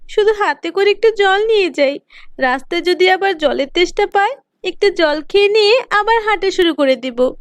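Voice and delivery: put-on a hurt girl deciding to leave home; young girl Lata.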